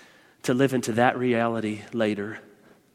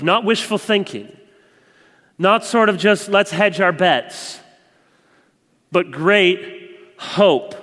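louder, slowing to a stressed close; fervent preaching; 7 semitones higher, 8 dB louder, slower than usual. Acted. Preacher urging the church toward great hope, fervent. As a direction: build to the word great.

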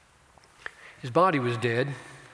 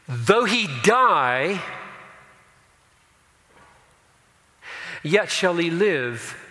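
loud slow ringing proclamation; proclaimed; 5 semitones higher, 6 dB louder, slower than usual. Acted. Preacher proclaiming the verse to mourners, loud and slow. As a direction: stretch each word out like a promise.

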